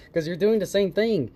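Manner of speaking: ordinary talk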